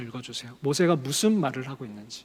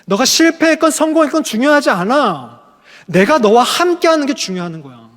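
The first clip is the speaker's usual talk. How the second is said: higher, louder emphatic declaration; rising proclamation; 4 semitones higher, 7 dB louder than usual. Acted. Preacher proclaiming God's answer to Moses, loud and high. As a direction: build the assurance to a ringing height.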